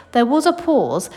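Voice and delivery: ordinary delivery, nothing marked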